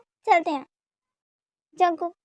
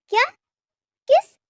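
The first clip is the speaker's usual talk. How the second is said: high, loud startled question; shocked disbelief; 10 semitones higher, 6 dB louder than usual. Acted. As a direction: blurt it out, jumping up in pitch. Shocked to hear of the kiss.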